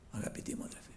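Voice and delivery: hushed whisper. Real, not acted. Sudden hush to congregation.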